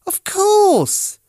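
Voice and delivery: very high, loud eager exclamation; light up with sudden understanding; Teddy realising. A narrator's reading. Teddy realising Monkey's nose is a button, very high and eager. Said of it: "he said".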